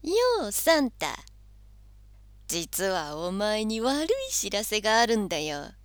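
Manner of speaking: cocky little devil calling out. Acted. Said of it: "こう言いました".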